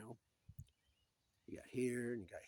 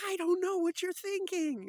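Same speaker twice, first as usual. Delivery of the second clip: very high, loud, slow holler; overjoyed man, acted over the top; hammed-up elation; 12 dB louder than usual. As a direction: shout it out in comic jubilation. Acted joy, shaking people.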